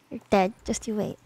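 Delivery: a hint of a low playful threat; low mock threat. Streamer half-jokingly threatens Aqua in a low voice.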